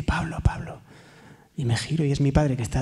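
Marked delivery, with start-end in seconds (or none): 0.0-0.8 soft whispered call of a name; whispering father. Retold or imitated. Sick father in ICU whispering to his son. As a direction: call him over in a faint whisper. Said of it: "una voz que dice".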